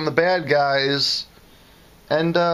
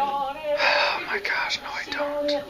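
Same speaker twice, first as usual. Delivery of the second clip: high whisper; 4 dB quieter, much slower than usual. Real, not acted. Embarrassed denial.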